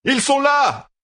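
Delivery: crié très haut et fort; cri. A narrator's reading. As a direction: hurler l'alerte. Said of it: "cria Matt au garde". (Matt crie l'alerte à la garde)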